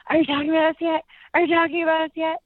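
put-on high, eager little-kid voice; imitated pestering kids. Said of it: "and they're like".

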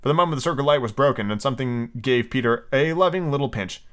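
usual speaking voice

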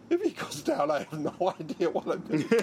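words pitched high and broken by laughter; laughing while talking. Real, not acted. Laughing as he tells the interviewer about the neurologist walking beside him. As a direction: laugh it out in disbelief. Laughing imagining colleague collapsing.

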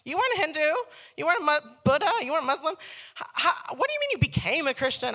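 high, loud disbelieving outrage; indignant. Retold or imitated. Sister baffled by her born-again brother, indignant. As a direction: throw the questions back at him.

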